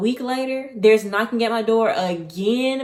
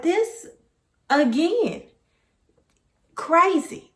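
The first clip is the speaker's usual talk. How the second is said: incredulous; 8 semitones higher, much slower than usual. Retold or imitated. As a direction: spell out your shock word by word. Reacting to creepy visitor.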